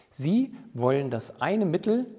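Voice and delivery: ordinary talk, nothing marked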